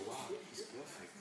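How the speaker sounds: breathy mock-awed whisper of praise; mock awe. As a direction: imitate a dazzled listener, half-whispered. Mocking admiration of perfect speaker.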